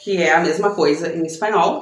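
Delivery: ordinary delivery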